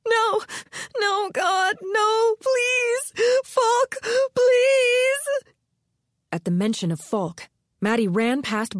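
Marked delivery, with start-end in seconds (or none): 0.1-5.5 much higher, louder wailing pleas; hysterical sobbing. Acted. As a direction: beg through hysterical sobs. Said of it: "the woman sobbing".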